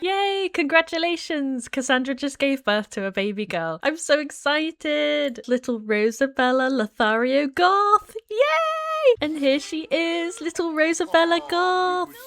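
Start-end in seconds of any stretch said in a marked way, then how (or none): 0.0-1.7 high cheer of congratulations; excited cheer. Real, not acted. Baby is born. 3.8-12.1 cooing delight. Real, not acted. Cooing over the newborn.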